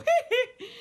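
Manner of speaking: high, loud falsetto squeal; playful falsetto hiccup of glee. Acted. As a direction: squeak out a tiny pop-star giggle. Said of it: "a bit like [name]".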